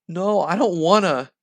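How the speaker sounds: put-on high, loud childish protest; petulant put-on kid refusal; acted tantrum. A narrator's reading. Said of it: "like a toddler throwing a tantrum".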